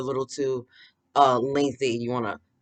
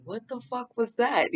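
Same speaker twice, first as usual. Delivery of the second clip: shocked friend; 11 semitones higher, slower than usual. Retold or imitated. Friend's shocked quote acted out.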